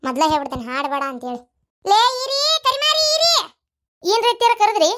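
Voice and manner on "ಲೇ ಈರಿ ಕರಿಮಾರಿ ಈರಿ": high, loud call across the house. Acted. Mother-in-law summoning the daughter-in-law, loud and high. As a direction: shout her name to summon her.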